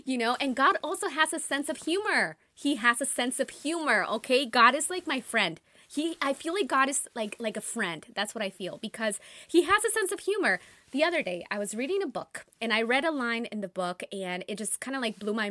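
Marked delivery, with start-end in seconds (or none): 0.1-10.6 excited. Real, not acted. Excited laughing talk.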